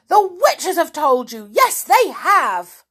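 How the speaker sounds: high, loud creature's tantrum; enraged screaming. A narrator's reading. Little man found out by the queen, screaming in rage. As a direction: scream the accusation, stretching the end. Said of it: "screamed the little man in a rage".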